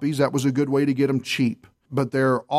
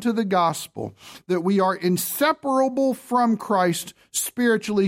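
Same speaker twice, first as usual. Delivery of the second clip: lightly raised, slow emphatic sermon voice; emphatic preaching; 5 semitones higher than usual. Acted. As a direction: press each point home from the pulpit, unhurried. Preaching at full force.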